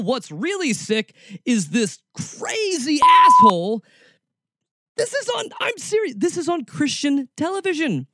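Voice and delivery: high-pitched, appalled disbelief; incredulous outrage. Real, not acted. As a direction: rant in stunned disbelief, voice pushed way up. Host reacts appalled to played clip.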